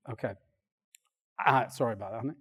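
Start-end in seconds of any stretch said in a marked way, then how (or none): none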